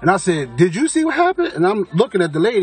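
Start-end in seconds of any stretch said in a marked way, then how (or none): 0.6-1.5 high-pitched retold astonishment; urgent question. Narrator asking the dude coming over, urgent questioning. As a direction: re-enact asking a witness, voice shooting up.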